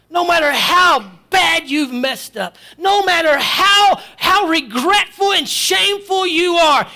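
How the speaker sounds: loud, high, driving preacher's cry; preaching at full force. Acted. Preacher shouting to the congregation, full force preaching. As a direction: hammer it home to the congregation.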